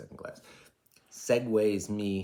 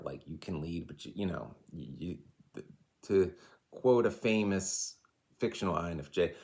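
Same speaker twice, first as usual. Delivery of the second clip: ordinary talk, nothing marked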